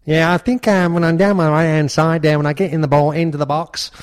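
a hammed-up Cockney footballer talking about his cross; a Cockney accent like calling the Grand National. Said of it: "Harry Kane or David Beckham... Cockney vibe".

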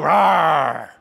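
mock dog growl in tug of war; playful growl. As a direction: growl like playing with a puppy. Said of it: "you know what we do?".

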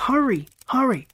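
high, urgent cries; two sharp high exclamations; roared urging. A narrator's reading. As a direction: shout to rush them along. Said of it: "Scott roared".

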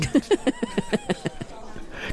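real high chuckling; laugh. Real, not acted. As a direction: giggle at your own joke. Laughing at own brulee joke.

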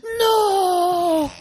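high, loud mock yell; mock shout. Pretending locals' shouting is engagement, a high loud mock no. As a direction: bark out a pretend angry no. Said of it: "När de bara".

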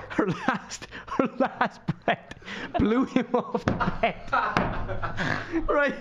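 high, helpless laughter through words; cracking up, pitch way up; laughing while talking. Real, not acted. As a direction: struggle to get it out through real laughter. Cracking up at own story.

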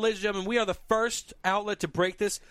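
loud breaking news announcement; big announcer delivery. Pretend. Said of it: "Breaking news".

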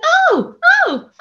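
imitating joyful amazement; father seeing loved ones. Dying father recognising loved ones, high amazed cries. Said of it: "he's going".

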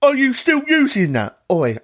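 лёгкая пародия на грубого качка; резкий окрик «Oi», чуть выше голос; rude gym bloke. Retold or imitated. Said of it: "I love nothing more than being called "Oi".

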